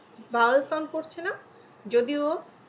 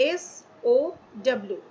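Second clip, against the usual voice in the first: slow spelled acronym; 3 dB louder, much slower than usual. Shown as a demonstration. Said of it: "তখন হচ্ছে".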